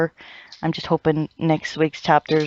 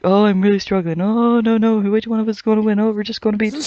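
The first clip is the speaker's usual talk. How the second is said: higher, louder hammed-up battle cries; mocking struggling fighters; 5 semitones higher, 5 dB louder than usual. Sneering at the endless Zaraki fight, hammed up loud. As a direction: overact the fight like a cheesy shonen duel. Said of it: "it was like".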